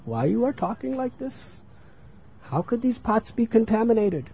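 high, soft puzzled protest; questioning child. Acted. Child on the pots answers his mother, questioning.

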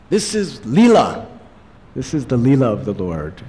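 confident proclaiming of the claim; mock the claim some people make; claim of others. Preacher voicing what others say, lightly mocking. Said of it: "they say".

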